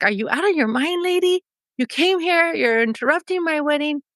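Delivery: acted incredulous scolding; mild indignation. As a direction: imitate an affronted young bride. Acted teen scolding the intruder.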